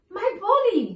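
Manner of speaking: gasp, then cry it out high and loud; a sudden shock at finding it. Acted. Discovering the lost things.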